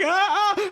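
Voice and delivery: two very high shrieks; mock panicked scream. Player grabbed by the monster's tongue, panicked screams. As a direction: imitate shrieking as something grabs you. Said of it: "And I'm like".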